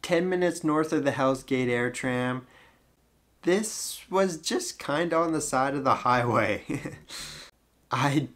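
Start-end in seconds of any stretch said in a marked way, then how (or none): none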